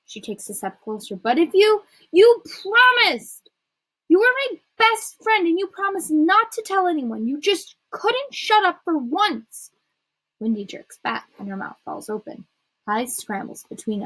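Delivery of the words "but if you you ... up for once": high, heated outburst, key words drawn out; hurt, angry accusation. A narrator's reading. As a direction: accuse your best friend of betrayal. Hurt friend accuses in quarrel.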